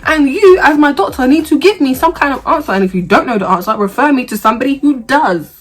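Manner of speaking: high, loud, fast indignant rant; assertive rant. Retold or imitated. Patient ranting at her doctor, high, loud and indignant. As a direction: let it all out at the doctor. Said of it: "Because I'm being assertive".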